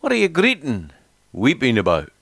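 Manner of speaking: put-on a light Scottish boy's lilt on the question; Scottish dialect. A narrator's reading. Little boy Robbie comforting his weeping mother, light Scottish dialect. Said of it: "in his quaint Scottish dialect".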